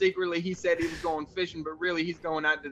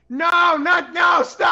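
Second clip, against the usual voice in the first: slow, loud urgent shout; 10 semitones higher, 9 dB louder, slower than usual. Real, not acted. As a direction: shout to stop them. Urgent stop during puzzle.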